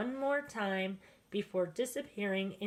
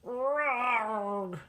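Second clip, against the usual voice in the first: rumbling bear growl, louder; growled roar; 2 semitones higher, 4 dB louder than usual. A narrator's reading. The bear answers about jam, rumbling growl. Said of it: "Old Bear growled".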